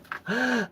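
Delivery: sharp, high mock gasp of shock; imitated gasp. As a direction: gasp like she's just been caught out. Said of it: "And she goes".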